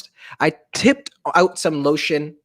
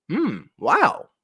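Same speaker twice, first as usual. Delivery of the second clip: higher, polite but unimpressed; unconvinced wow; 7 semitones higher than usual. Acted. Mom trying to sound pleased with her spa, unconvinced. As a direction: fake an impressed hum. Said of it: "she sounded like it wasn't wow".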